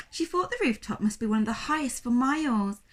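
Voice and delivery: usual speaking voice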